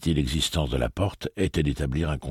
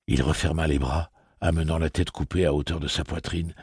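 ordinary talk

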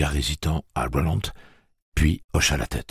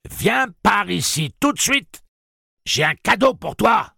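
insistent crying out; 4 dB louder than usual. A narrator's reading. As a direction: draw out the call, then shout it high. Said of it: "Puis elle s'écria".

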